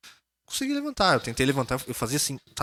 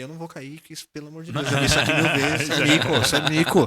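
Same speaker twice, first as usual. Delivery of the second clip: loud, laughing, higher inner protest; laughing inner thought; 4 semitones higher, 8 dB louder than usual. Retold or imitated. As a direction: laugh through the stubborn refusal to fall. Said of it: "Aí eu pensei".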